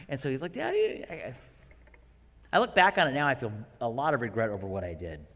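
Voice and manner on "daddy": put-on much higher small child's cry; a scared little boy calling his dad; higher, small plea, like a scared two-year-old; frightened toddler. Toddler's plea after scary face.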